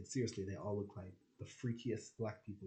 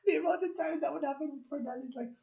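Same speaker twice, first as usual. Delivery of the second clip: laughing high; 8 dB louder than usual. Real, not acted. Laughing retelling.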